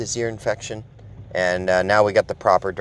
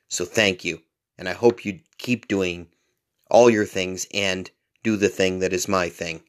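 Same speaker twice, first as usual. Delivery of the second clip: ordinary delivery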